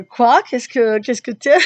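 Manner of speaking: startled outburst. Retold or imitated. She relives facing the groaning man, loud incredulous questions. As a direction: blurt it out, thrown off guard. Retelling her startled reaction.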